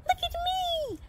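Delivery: very high tiny squeal; pixie's gleeful scream. A narrator's reading. Pixie showing off to Santa, gleeful squeal. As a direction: show off in a squeaky little voice. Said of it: "he screamed".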